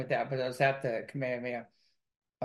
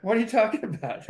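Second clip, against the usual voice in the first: high-pitched retold confusion; puzzled, imitating a startled reply; baffled comeback; 6 semitones higher than usual. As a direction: act out being totally clueless, pitch lifted. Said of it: "And we go".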